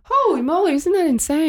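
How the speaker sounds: high, excited disbelief; amazed. Real, not acted. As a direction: gasp it out in amazement. Amazed reaction.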